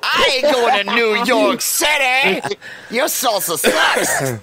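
slow, drawn-out mock outrage; hammed-up cowboy refusal; commercial cowboy holler. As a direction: play a stubborn salsa ad cowboy, laughing. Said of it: "Papa Dave's on the commercial like".

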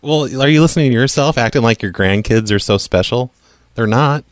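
usual speaking voice

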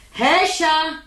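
high call across the flat. Acted. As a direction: call the daughter from another room. Mother calls daughter to leave.

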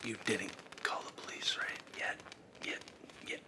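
soft breathy ASMR whisper, nervous underneath; hushed ASMR voice with jittery nerves. Acted. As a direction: whisper like a creepy ASMR host. Whispering intruder.

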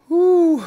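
loud high exhale of relief; relieved whew. Real, not acted. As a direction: blow out relief. Relief after coaster.

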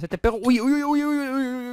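ordinary talk, nothing marked

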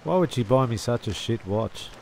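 usual speaking voice

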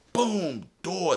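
high, falling boom; excited storytelling sound effect; slamming exclamation. Retold or imitated. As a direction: punch each word like a slamming door. Acts out the door slam.

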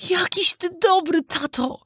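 high-pitched, moved little Pinocchio; small high voice full of gratitude; touched little puppet boy. A narrator's reading. Pinocchio thanking his father, touched, high child voice. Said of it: "mówiąc, wzruszył się Pinokio".

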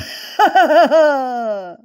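high acted belly laugh; jolly storybook ha-ha-ha. A narrator's reading. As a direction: laugh heartily as the teasing bunny. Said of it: "laughed Uncle Wiggily in his jolly voice".

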